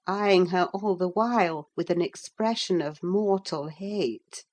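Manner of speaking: plain reading voice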